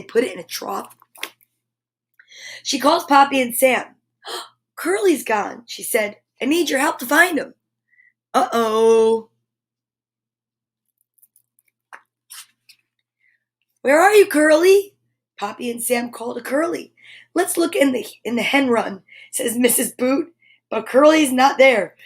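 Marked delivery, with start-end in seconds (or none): 2.6-9.3 bright, lively storybook read-aloud; animated picture-book narration; reading to children. A narrator's reading. Reads picture book to kids. 13.8-14.9 high, loud call across the farm; children shouting for Curly. Acted. Children calling for lost pig. 15.4-22.0 bright, lively storybook read-aloud; animated picture-book narration; reading to children. A narrator's reading. Reads picture book to kids.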